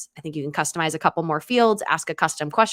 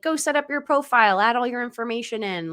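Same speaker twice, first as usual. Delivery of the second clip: cheery organizer; 6 semitones higher than usual. Retold or imitated. Voicing organizer's later request to guests.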